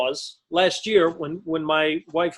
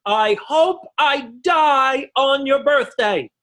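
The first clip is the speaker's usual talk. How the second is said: higher, louder, slow spiteful comeback; higher mock-spiteful mother; bitter retort; 7 semitones higher, 3 dB louder, slower than usual. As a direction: spit it back as the furious mother. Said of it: "her mother say the brilliant line".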